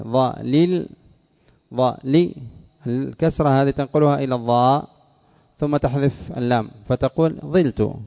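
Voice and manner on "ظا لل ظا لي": "ظا لل، ظا لي" split into ẓa, then lil or li; syllables shown separately. Shown as a demonstration. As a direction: separate "ظا" from "لل" and "لي" to show the kasra. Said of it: "اللام المكسورة هذه". ("ظا لل، ظا لي" بتقطيع يبرز كسرة اللام)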